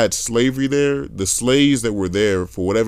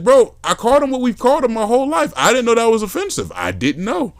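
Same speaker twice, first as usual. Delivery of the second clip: high-pitched, louder reenacted protest; protesting innocence; 14 semitones higher, 4 dB louder than usual. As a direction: plead ignorance in a high voice. Defending himself to manager.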